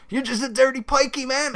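high mocking dude voice. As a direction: sneer it out like a bigot. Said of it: "being like".

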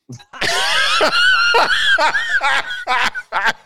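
long, very high loud laughing shout; howling laugh. Real, not acted. Son offers to fight bullies.

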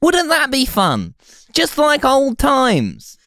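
high, slow, wheedling fake-dad voice; creepy stepdad voice. Creepy stand-in dad comforting listeners.

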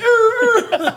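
real laugh, high and loud; laughing at his own story; high cackling laugh. Real, not acted. Laughs at frozen dog story.

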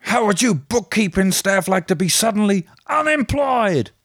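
high, put-on character voice; film character impression. Host quoting a film boss to bookkeeping staff, high put-on voice. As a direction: pitch it right up, hammed-up movie quote.

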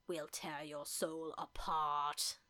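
low, slow menacing line with a German tinge; mock German accent. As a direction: growl it out like a German villain. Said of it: "Like German?".

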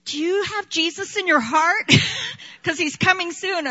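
put-on girl at a stranger's door, eagerly asking about Jesus; eager young evangelist. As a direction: play her eager child self selling Jesus. Imitating her young evangelizing self.

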